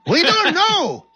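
high, slow, loud call; drawn-out shouted answer; soldiers calling back. Retold or imitated.

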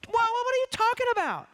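a touch of stammering disbelief, pitched high; hammed-up high confused stammer; mock bewilderment. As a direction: sputter it out, baffled. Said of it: "you almost hear them in the subtext saying".

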